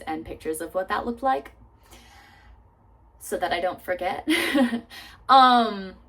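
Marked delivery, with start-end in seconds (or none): none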